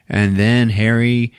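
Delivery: usual speaking voice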